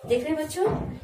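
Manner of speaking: ordinary talk, nothing marked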